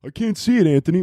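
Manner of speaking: high, put-on whine; mock complaining listener. Listener complaining to the host, mock whiny. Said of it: "anyone who's listening is gonna be like".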